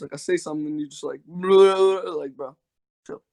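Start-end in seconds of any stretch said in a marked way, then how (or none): 1.3-2.2 garbled "bleh" imitating rushed speech; mock rapid babble. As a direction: blurt a gibberish blur, mimicking someone talking too fast. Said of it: "going on a little bit too fast".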